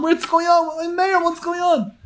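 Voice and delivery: high, urgent panicked questioning; frantic. Retold or imitated. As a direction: chase him demanding answers. Frantic grandmother running after.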